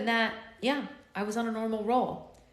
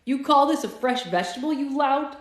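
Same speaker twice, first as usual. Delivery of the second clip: higher, louder angry challenge; indignant scolding; 6 semitones higher, 7 dB louder than usual. Acted. Sam berating the vendor over a rotten tomato, loud and indignant. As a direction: tear into the vendor.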